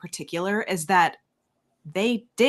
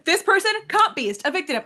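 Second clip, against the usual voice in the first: stifled laugh; 9 semitones higher than usual. Retold or imitated. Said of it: "couldn't even say it with a straight face".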